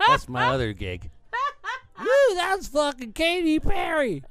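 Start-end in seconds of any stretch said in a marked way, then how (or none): 2.0-4.2 high, loud, stretched-out announcer whoop; over-the-top sportscaster parody; Will Ferrell-style impersonation. Acted. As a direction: holler slowly like a hammed-up baseball commentator. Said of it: "You sound like when Will Ferrell does that fucking impersonation".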